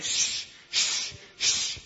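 unvoiced hushing shh; shushing like the devil. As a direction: hush them like the devil in church. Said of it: "Kinda like that devil does around church".

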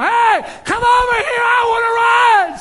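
put-on high-pitched, full-out child's yell; hollering little boy. Little boy still calling to the boat, insistent high shout. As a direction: holler across the water, stretching the last words. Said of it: "The boy just kept right on hollering".

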